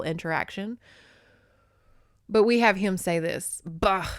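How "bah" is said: usual speaking voice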